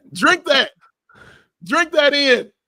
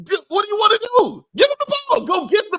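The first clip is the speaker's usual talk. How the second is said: heated yell; 9 semitones higher than usual. Real, not acted. Host venting at a player, yelling high and exasperated. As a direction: drive the point home hard.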